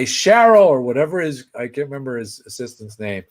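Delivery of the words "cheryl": loud, high holler for an assistant; calling out. Retold or imitated. Comedian shouting for his assistant, calling out. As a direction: imitate a boss summoning someone offstage.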